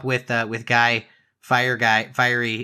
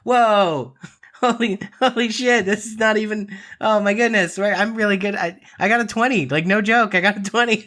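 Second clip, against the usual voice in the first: high-pitched, thrilled astonishment; giddy, high-pitched exclaiming; real excited disbelief; 8 semitones higher, 3 dB louder than usual. Real, not acted. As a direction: blurt it out, amazed at your own luck. Stunned by a natural twenty.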